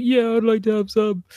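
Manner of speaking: high small voice, now keen; father imitating his son; child voice. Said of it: "And he was like".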